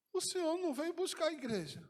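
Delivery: high, loud, stammering tearful questions; weeping man asking Jesus why he came; tearful pleading. Retold or imitated. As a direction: beg for an answer through tears. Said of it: "Eu já falei chorando".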